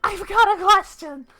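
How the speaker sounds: put-on high eager kid voice; annoying kid voice. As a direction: bounce in like a pushy child. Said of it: "my annoying kid voice".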